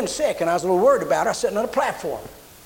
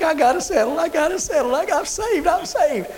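high, overjoyed cry of relief; joyful sobbing laugh, much higher; 9 semitones higher than usual. Retold or imitated. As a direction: squeal it out, laughing and weeping at once. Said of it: "She's crying and laughing at the same time".

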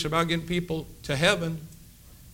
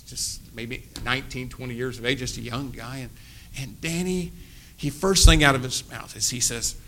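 ordinary talk, nothing marked

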